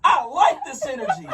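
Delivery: high, loud whoop of delight; mock excited reply. Acted reply to a tipsy text, high excited shout. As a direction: shout it high and thrilled, laughter creeping in.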